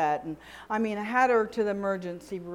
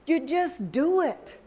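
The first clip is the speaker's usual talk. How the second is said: normal talking voice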